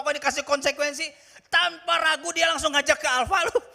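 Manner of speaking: loud, high, driving sermon voice; fervent pulpit delivery; full-force preaching. Acted. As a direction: preach it out with fire.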